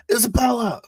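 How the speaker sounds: high, loud full-lunged yell; urgent, high-pitched shouted order. Acted. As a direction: shout it across a catwalk at the top of your lungs. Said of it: "Just yell at the top of my lungs".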